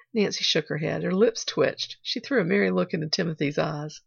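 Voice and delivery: ordinary delivery, nothing marked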